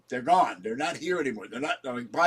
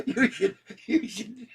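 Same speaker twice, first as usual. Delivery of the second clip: higher, breaking into laughter; laughing; 7 semitones higher, slower than usual. Real, not acted. Cracking up before the Pelosi joke, laughing. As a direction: giggle through the words.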